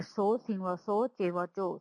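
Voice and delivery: ordinary talk, nothing marked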